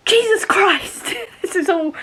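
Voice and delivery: stunned outburst at the page; shocked exclamation. Real, not acted. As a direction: gasp it out in disbelief. Shocked by plot twist.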